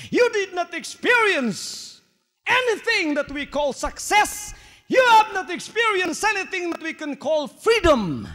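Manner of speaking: building sermon intensity; preaching. Acted. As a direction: lift the voice, pressing the crowd. Preacher rising in force.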